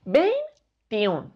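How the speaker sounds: "Veintiún" split in two with a clear gap; syllable by syllable. Shown as a demonstration. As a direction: break "Veintiún" into separate syllables. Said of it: "Separamos la palabra veintiún en sílabas".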